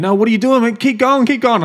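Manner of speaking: high, loud mock cheer of keep going; mock policeman shouting. Retelling police yelling at him to keep running, high and loud. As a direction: ham up the cop urging him on. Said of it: "policemen there going".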